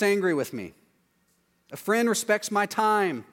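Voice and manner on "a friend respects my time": ordinary talk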